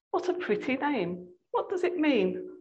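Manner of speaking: high, soft feigned curiosity; sweetly innocent witch. A narrator's reading. Witch asks Jill about Aslan, sweetly innocent. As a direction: ask lightly and high, softening the voice.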